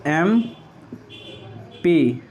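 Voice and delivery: MP stretched out very slowly while writing; drawn out. Shown as a demonstration. Teacher at the board, drawing out MP very slowly. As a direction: drag MP out long as you write it. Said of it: "क्या लिखिएगा?".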